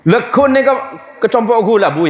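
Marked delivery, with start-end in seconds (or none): none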